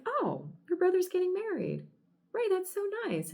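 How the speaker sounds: high-pitched gushing over news; delighted squeal of approval, put on; acted delight. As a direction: coo it high, like happy congratulations.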